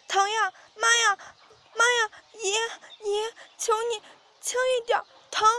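high, loud cries for mercy; acted high-pitched begging in pain; pained pleading. A narrator's reading. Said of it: "哭爹喊娘，不停地求饶".

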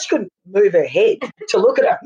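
usual speaking voice

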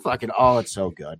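ordinary delivery

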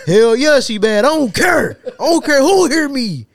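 loud, high acted boast; mock loud defiance. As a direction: proclaim it brazenly for all to hear. Acting out friend defying everyone.

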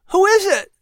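put-on curious boy calling from bed; high young boy asking; Patrick. A narrator's reading. Patrick, child voice.

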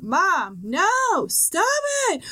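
child's high, loud protest, mimicked; imitated sleep yell. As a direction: imitate a kid yelling out in sleep. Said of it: "like, yell out".

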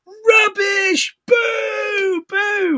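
very high, drawn-out mock booing; mock a jeering crowd in a high wail; high-pitched imitation heckle; imitated hecklers. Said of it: "that's what they sounded like".

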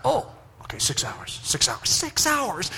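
slow, breathy repetition to himself; hushed, dazed repeating; stunned whisper. Retold or imitated. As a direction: let it sink in. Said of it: "It's like".

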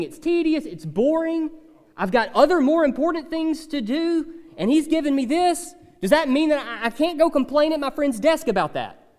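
lightly voiced grumbling worker; complainer. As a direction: plead your case to the pastor. Imagined complaining listener.

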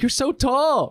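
high gushing imitation; mock proud parent. Classmate greets taller friend, mock proud-parent gushing. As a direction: coo like a long-unseen relative, half laughing. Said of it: "like a proud parent".

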